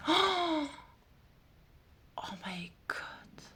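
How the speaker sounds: breathless, very soft whisper of awe; awed whisper. Real, not acted. Vlogger gasps over the panda card in a whisper. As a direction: let it escape on air, barely voiced.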